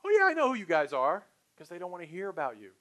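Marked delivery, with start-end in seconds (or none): 0.0-1.3 high, loud mock brush-off; fibbing prospect. Prospect dodging a sales pitch, glibly fibbing. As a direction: brush the salesperson off with eager false recognition. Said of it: "So they lie to you".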